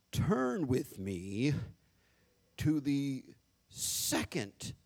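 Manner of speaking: very slow, stately mock-preacher intonation; put-on, drawn-out church oratory; fake preacher voice. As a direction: draw out each phrase like a pompous pulpit reader. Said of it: "You got that fake voice".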